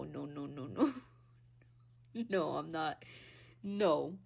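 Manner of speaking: normal talking voice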